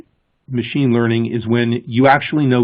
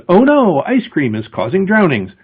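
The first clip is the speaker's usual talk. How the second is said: high, alarmed oh no; mock alarm; 6 semitones higher than usual. A human misreads the data, mock alarmed. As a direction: gasp it out in mock panic. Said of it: "a human says".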